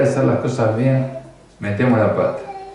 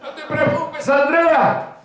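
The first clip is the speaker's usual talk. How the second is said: very high, laughing put-on voice; mock reassurance; 14 semitones higher than usual. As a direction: comfort her in a silly falsetto, chuckling. Mock pastor reassures Andrea.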